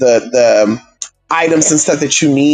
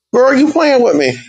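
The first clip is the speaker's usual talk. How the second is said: exasperated; 9 semitones higher than usual. Real, not acted. Exasperated outburst.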